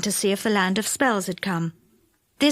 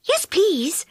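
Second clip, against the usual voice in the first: polite; 8 semitones higher than usual. Acted. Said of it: "in her most polite voice".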